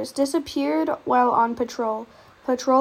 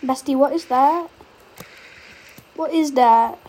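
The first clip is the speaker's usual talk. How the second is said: scared horror game streamer; 7 dB louder than usual. Real, not acted. Sees something in the dark.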